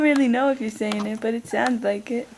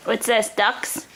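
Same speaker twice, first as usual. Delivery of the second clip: normal talking voice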